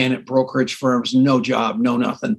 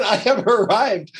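mock triumph; 5 semitones higher, slower than usual.

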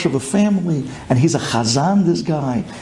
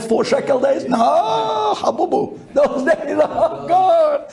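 mock lament; 10 semitones higher, slower than usual. Mock lament over exchange rate.

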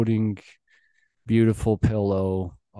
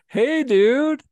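squeaky, very high and louder; high cutesy greeting; 6 dB louder than usual. Retold or imitated. Greeting the ferret that followed him, very high squeak. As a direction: greet it like a tiny cute animal. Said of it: "I'm like".

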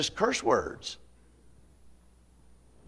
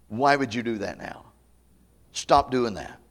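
ordinary talk, nothing marked

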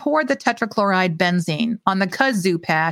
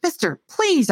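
begging; 9 semitones higher than usual. Acted. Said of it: "I begged".

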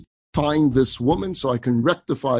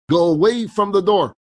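louder, firmer warning; 6 semitones higher, 6 dB louder than usual. Retold or imitated. Husband losing patience with the rabbi, louder, firmer. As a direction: push him off, harder this time. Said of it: "he gets louder and firmer".

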